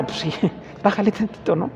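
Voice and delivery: amused, hushed telling-off; laughing retort. Retold or imitated. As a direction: tease them gently through a laugh. Teasing retort to the shouter.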